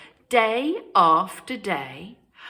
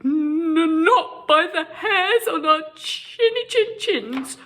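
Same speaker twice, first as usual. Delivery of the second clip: higher, louder trembling squeak; 10 semitones higher, 6 dB louder than usual. Acted. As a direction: quaver with fear. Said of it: "trembled Boris".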